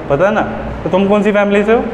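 usual speaking voice

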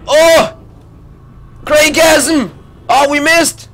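usual speaking voice

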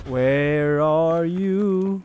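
very slow, stretched-out call; slow searching call to a hidden enemy; drawn-out call. Acted. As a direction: drag every word out.

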